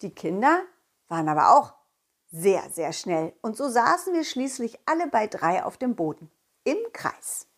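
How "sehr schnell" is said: ordinary delivery, nothing marked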